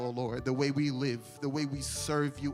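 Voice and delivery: normal talking voice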